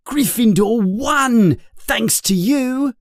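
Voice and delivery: put-on high, eager boyish voice; excited friend. Ron congratulates Harry on his catch, high and eager. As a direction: cheer your friend on brightly.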